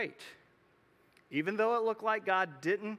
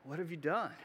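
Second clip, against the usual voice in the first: softer, grave question from above; God's quiet rebuke; 5 dB quieter than usual. Retold or imitated. God confronting Adam and Eve in the garden, soft and grave. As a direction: ask it softly but heavily. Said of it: "God comes back and he says".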